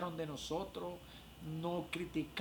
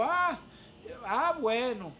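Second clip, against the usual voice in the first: high, loud "ah" easing down; retold exclamation, pitched up and emphatic; imitating amused mother-in-law; 5 semitones higher, 4 dB louder, slower than usual. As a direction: act out a mother-in-law's knowing reaction. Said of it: "se rió y me dijo".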